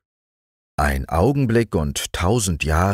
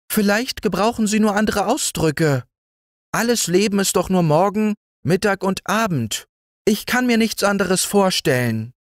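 very high, light mayfly voice; innocent mayfly; 13 semitones higher than usual. A narrator's reading. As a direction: muse gently as the mayfly. Mayfly musing.

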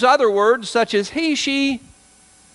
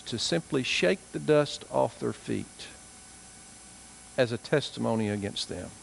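solemn preaching; 7 semitones lower, 7 dB quieter than usual. Real, not acted. Preacher warning hardened hearts, soft and low. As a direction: speak gravely and gently, heavy pauses.

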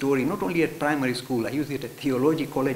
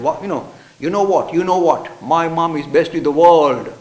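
put-on boastful boy; 11 dB louder than usual. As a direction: brag like a schoolboy.

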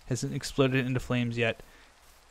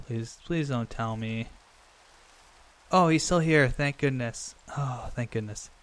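ordinary talk, nothing marked

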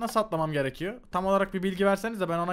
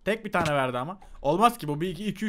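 ordinary talk

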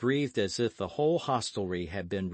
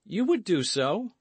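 high, wavering-minded question; uncertain, voice pitched well up; undecided; 11 semitones higher, 5 dB louder than usual. A narrator's reading. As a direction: ask it half tempted, half doubting.

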